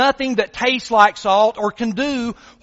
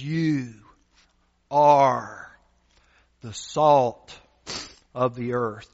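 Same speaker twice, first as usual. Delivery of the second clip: lower, softer, slow and intimate; soft tender quote; 10 semitones lower, 5 dB quieter, slower than usual. Acted. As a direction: speak it gently to the family of God. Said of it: "he looks at these people and he says".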